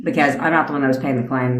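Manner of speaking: ordinary talk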